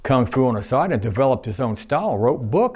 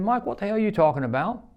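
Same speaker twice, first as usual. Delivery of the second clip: incredulous question; 10 semitones higher than usual. Retold or imitated. Confused retort to coworker.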